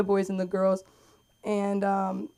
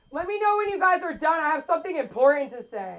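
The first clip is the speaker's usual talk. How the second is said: high-pitched imitation of an interrupting dad; dad calling out; 7 semitones higher than usual. Dad butts into mother-daughter talk from another room, calling out. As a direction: call through from the next room as dad. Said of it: "from the other room, my dad's like".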